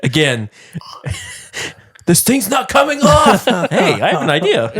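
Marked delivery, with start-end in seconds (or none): 2.0-4.8 high, laughing through words. Real, not acted. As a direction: giggle out the words.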